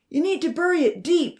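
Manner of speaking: high, loud call. A narrator's reading. Charlie ordering Odin to bury the chicken, calling out. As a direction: call it out forcefully. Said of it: "Charlie called out".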